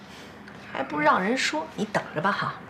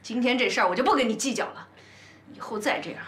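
normal talking voice

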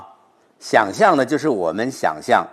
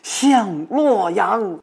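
much higher and louder, drawn out slow at the end; urgent recitation; 10 semitones higher, 5 dB louder, much slower than usual. Shown as a demonstration. Professor showing the hurry of the journey home. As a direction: soar up eagerly, stretch 向洛阳. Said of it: "着急了".

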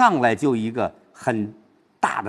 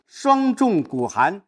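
high, drawn-out verse; slow recitation; 7 semitones higher, much slower than usual. Shown as a demonstration. Teacher setting up a light stress, high slow recitation. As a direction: hold each word up high and slow. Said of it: "只有轻读声音才没起来".

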